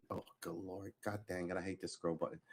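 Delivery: very soft, annoyed muttering to himself; quiet grumble. Real, not acted. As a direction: grumble at the mouse under your breath. Annoyed at the scroll button.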